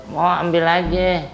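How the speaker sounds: ordinary talk, nothing marked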